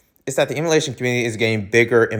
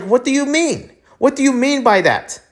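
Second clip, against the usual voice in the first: incredulous outrage; 10 semitones higher than usual. Real, not acted. Outraged rant at the news.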